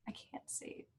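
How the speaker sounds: breathy whispered aside. Real, not acted. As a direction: drop to a whisper. Whispered aside, can't see screen.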